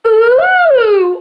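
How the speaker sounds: loud, high awed "ooh"; shouted audience cheer. Acted. Magician voices the missing audience, shouting an impressed ooh. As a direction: imitate an impressed crowd oohing. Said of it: "No one to shout".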